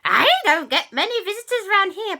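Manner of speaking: high, loud cackle then a squeaky greeting; cackling cat witch. Acted. Cat witch greeting unexpected visitors, cackling high and loud. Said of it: "a strange creature. And she said".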